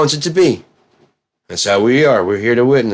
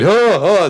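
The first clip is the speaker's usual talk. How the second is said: startled gasps; 10 semitones higher than usual. Retold or imitated. Imitating a startled gasp.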